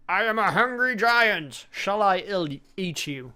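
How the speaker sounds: giant voice. Acted. Game's giant threatening the player, in a big hammed-up voice. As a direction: boom it out like a menacing storybook giant. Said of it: "A giant voice says".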